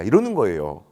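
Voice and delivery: ordinary delivery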